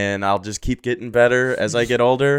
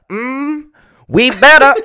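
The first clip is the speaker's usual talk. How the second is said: much higher, loud squeaky drawl; mock Cat Williams; 14 semitones higher, 7 dB louder, slower than usual. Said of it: "talk like Cat Williams".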